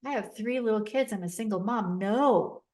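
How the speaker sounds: loud, frantic protest; panicked refusal, loud. Retold or imitated. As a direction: plead in a panic not to die. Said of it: "I'm panicked".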